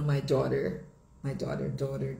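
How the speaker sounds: American "daughter", tapped T and final R; sotaque americano. Shown as a demonstration. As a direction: say "daughter" as "daw-der", American. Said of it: "em inglês americano". (sotaque americano, T batido e R final)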